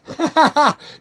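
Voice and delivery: loud amused cackle after the insult; burst of laughter. Real, not acted.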